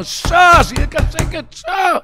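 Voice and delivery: high, loud shout for silence; desperate shout. Retold or imitated. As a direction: cry out over the whole table, pitched high. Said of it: "והתחיל לבכות ולצעוק".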